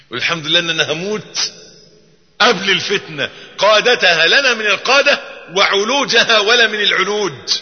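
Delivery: ordinary talk, nothing marked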